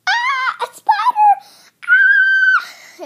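very high, loud shrieks about a spider; screaming in fright. Pretend. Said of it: "It went shouting all around".

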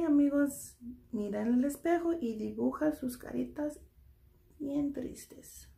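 ordinary delivery

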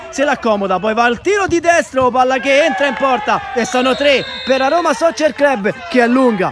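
loud, high goal shout; goal call. Real, not acted. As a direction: roar the third goal. Third goal scored.

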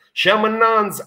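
siamo innanzi, proclaimed loud and high. Acted. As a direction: siamo innanzi, declaimed with force. Repeated louder, climax.

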